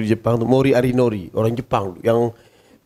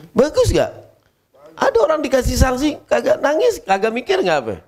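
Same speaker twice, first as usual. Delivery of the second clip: emphatic rising question; 9 semitones higher than usual. Real, not acted.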